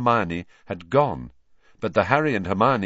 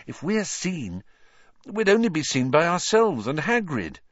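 put-on higher, anxious girl's warning; frightened urgent; 7 semitones higher than usual. A narrator's reading. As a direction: plead in frightened haste.

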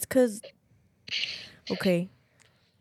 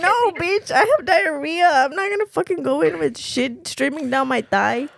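higher, louder exasperated protest; loud laughing insistence; 6 semitones higher, 6 dB louder than usual. Real, not acted. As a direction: insist loudly through laughter. Insisting she really has diarrhea.

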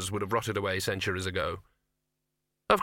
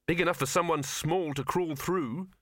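encouraging; 5 semitones higher than usual. A narrator's reading. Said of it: "said Victor encouragingly".

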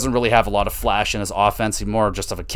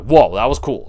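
impressed whoa; 5 dB louder than usual. Retold or imitated. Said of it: "I was like".

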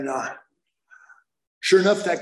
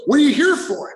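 nosy stranger; 10 semitones higher, 6 dB louder than usual. Retold or imitated. Stranger asks why he came, high and loud. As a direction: question voiced high and louder.

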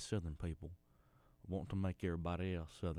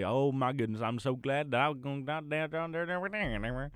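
imitating city folk; 7 semitones higher, 8 dB louder than usual. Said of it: "and they're gonna say".

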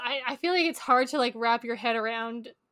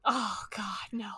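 breathy groaning whisper of dread; whispered dismay; 3 semitones lower, 7 dB quieter than usual. Real, not acted. Friend whispers in dread at the bad audiobook accents. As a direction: whisper it like you can't bear to hear.

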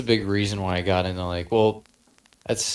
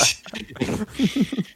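high squeal laugh; 13 semitones higher than usual. Real, not acted. Laughing at roast joke.

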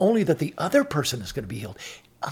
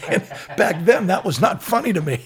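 louder, laughing through the words; laughing while talking; 5 semitones higher, 8 dB louder than usual. Real, not acted. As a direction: still chuckling as you say it. Laughing at memory.

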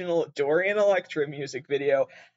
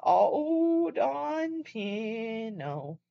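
drawn-out, very slow yowling "Oh, Long Johnson"; talking cat impression; 6 semitones higher, 3 dB quieter, much slower than usual. Imitating the famous talking cat video, slow drawn-out yowl. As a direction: stretch each word like a meowing cat. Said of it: "the kid that was videotaped saying".

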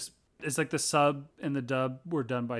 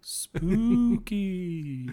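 drawn-out, higher, playfully eerie; mock spooky; 5 semitones higher than usual. Teasing co-host that it might be Odin, mock spooky. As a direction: stretch it out like a ghost-story tease.

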